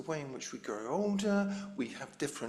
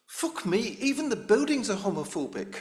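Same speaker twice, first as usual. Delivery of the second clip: put-on loud, higher-pitched Scottish accent; 5 semitones higher, 8 dB louder than usual. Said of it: "Sorry about the Scottish accent".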